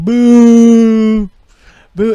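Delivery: loud booing like a talk-show audience; mock crowd booing. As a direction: boo Texas off the stage. States booing Texas on Maury.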